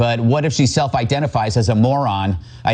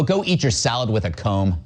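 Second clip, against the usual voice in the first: ordinary talk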